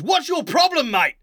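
high-pitched, confrontational challenge; aggrieved street tough picking a fight; furious man. Acted. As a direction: square up to a stranger, furious. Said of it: "absolute look of fury".

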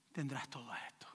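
a soft serpent's whisper; tempting whisper. Acted. Serpent whispering a promise to Adam and Eve, hushed. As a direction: breathe it like a temptation.

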